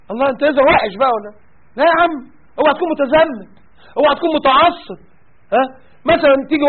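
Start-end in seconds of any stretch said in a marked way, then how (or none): 1.8-5.0 mocking warning. Mocking voice of the critics.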